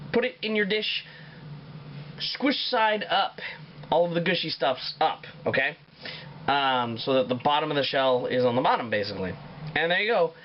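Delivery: ordinary delivery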